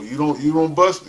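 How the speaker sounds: ordinary delivery, nothing marked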